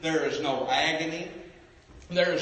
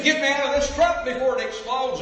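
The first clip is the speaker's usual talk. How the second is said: high, loud desperate cry; retold scream; 10 semitones higher, 7 dB louder than usual. Preacher voicing the burning trucker's plea, high desperate shout. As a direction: cry out as the trapped driver. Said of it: "And he began to scream".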